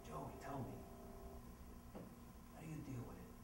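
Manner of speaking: hushed and very soft, barely voiced; almost a whisper. Gotti leans forward to ask Joey, near whisper. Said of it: "almost in a whisper".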